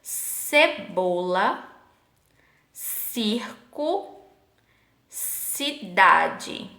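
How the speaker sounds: slow, split "Cebola, circo, cidade", each C an S sound; teacher sounding out "Cebola, circo, cidade" with long pauses; soft C demo. Shown as a demonstration. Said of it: "Perceba como muda totalmente".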